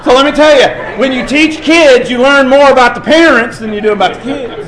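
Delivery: loud, high preaching with a laugh; preaching at full force. Acted. Preacher telling the congregation what teaching kids reveals, loud and laughing. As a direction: drive the lesson home.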